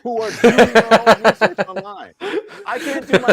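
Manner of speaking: mock the anti-vaxxers in a jeering voice; sneering mockery.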